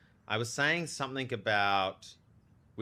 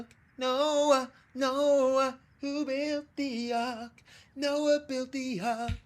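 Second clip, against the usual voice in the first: much higher, slow sing-song voice; high silly voice; 13 semitones higher, much slower than usual. Pretend. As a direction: drawl the answer in a high goofy voice. Mock sing-song quiz answer.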